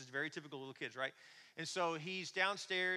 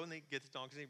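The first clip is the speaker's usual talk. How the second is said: ordinary delivery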